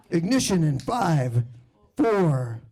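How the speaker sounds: hammed-up NASA countdown voice; countdown announcer. OCD NASA countdown guy.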